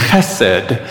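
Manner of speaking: Chesed with a throaty ch at the start; Hebrew pronunciation. Shown as a demonstration. Pastor teaching the congregation a Hebrew word, throaty ch. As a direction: scrape the ch of Chesed in the back of the throat, then sed. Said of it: "the first syllable is in the back of your throat".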